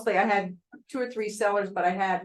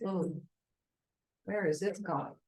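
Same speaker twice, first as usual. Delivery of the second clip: uneasy hush; 7 dB quieter than usual. Retold or imitated. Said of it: "the tone of voice was like".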